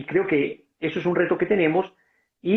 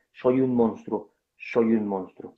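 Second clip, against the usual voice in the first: mild crying; 5 semitones lower, slower than usual. Retold or imitated. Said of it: "lloraba el tipo y decía".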